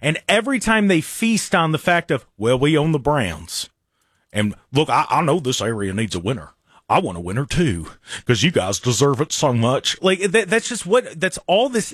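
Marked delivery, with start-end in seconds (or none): 2.4-10.0 mocking the owner. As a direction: play the owner buttering up the fans in a lower voice. Mocking owner's fan appeal.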